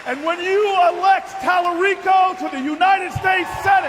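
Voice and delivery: high, loud campaign roar; preaching at full force. Acted. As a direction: shout it to the whole hall. Climax of campaign speech.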